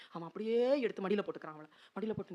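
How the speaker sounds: usual speaking voice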